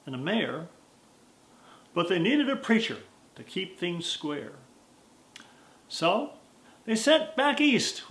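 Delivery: rhythmic storytelling cowboy verse; poem recitation. A narrator's reading.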